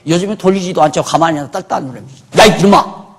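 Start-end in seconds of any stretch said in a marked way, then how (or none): 2.4-3.0 sudden loud, high shout; angry scolding dad. Acted. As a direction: yell at a naughty kid. Acted father scolding son over TV.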